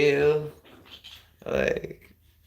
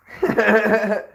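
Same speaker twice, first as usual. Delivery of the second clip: bursting laugh, high and loud; a real, loud burst of laughter; 8 semitones higher, 8 dB louder than usual. Real, not acted. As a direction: laugh out loud at your own joke. Laughs at own joke.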